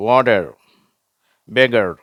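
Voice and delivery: வாட்டர் and பெகர் with a light final r; American pronunciation. Shown as a demonstration. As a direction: soften the t and barely touch the r in வாட்டர். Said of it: "அந்த டி கூட டி மாதிரி உச்சரிப்பாங்க".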